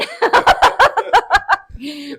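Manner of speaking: high, loud burst of laughter; real uproarious laughing fit; big laugh. Real, not acted. Laughing at her Marriage One joke.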